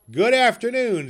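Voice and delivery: loud, high, curt goodbye; curt dismissal. Acted. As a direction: slam the door with your voice. Scrooge dismissing again.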